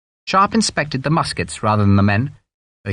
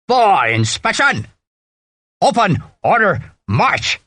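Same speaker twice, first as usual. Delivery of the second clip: high, drawn-out parade-ground call; bellowed drill command; 7 semitones higher, slower than usual. A narrator's reading. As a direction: bellow orders to the ranks. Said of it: "Harper bellowed".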